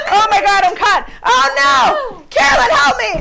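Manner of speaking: laughing shout. Real, not acted. Caught in outfit, laughing shouts.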